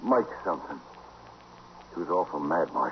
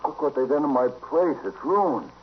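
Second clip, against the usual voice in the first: much higher, anguished complaint; distraught lament; 10 semitones higher than usual. Acted. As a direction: wail over the wrecked saloon. Bartender sees his ruined saloon.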